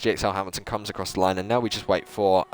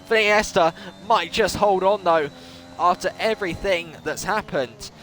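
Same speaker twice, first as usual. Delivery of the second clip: higher, lively race commentary; live play-by-play; 10 semitones higher than usual. Acted. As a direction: call the lap for the viewers.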